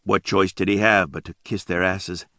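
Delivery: plain reading voice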